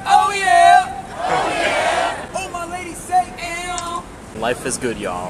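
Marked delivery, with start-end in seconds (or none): 0.1-1.0 high, loud excited whoop; cheering shout. Real, not acted. Cheering call. 1.2-2.3 loud raspy yell back; shouted cheer. Real, not acted. Shouted response. 2.3-4.0 high, lively calling out; excited hype call. Real, not acted. Hyping crowd.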